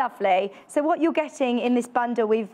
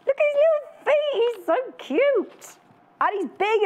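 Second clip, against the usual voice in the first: overwhelmed by cuteness; 11 semitones higher than usual. Real, not acted. Cooing over a cute toy duck.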